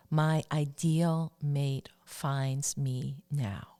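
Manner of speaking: very slow, deliberate affirmation; slow recitation for listeners to copy. Shown as a demonstration. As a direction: space out every word like a mantra. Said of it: "The third affirmation I'd like to share".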